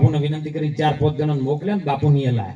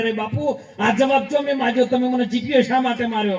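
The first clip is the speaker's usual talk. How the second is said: mock wailing complaint; 8 semitones higher than usual. Beaten shepherd cries out to the sadhu, high pleading wail. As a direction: whine it up high like a hurt shepherd. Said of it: "પેલો રાડ પાડવા માંડ્યો".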